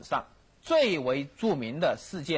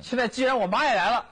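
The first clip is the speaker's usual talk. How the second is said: high-pitched, indignant retort; acted worked-up emperor; 6 semitones higher, 4 dB louder than usual. Young emperor answers his chief minister, worked up. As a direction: play a young ruler all worked up. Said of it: "也，也兴奋了".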